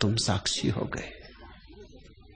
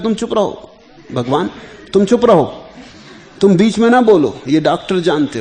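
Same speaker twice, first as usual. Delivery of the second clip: raised, higher voice imitating people hushing him; mock scolding; 8 semitones higher, 8 dB louder than usual. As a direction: imitate exasperated people shutting someone up. Retells people scolding Raman to be quiet. (डपटकर चुप कराने की नकल)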